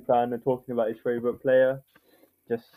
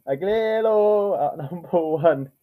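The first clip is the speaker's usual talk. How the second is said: commentator impression; 7 semitones higher, 4 dB louder, slower than usual. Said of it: "Oh, jeez, that was worse".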